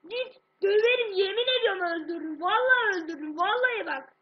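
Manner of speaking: screaming threats in rage; furious shouting. Real, not acted. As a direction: yell it, full fury.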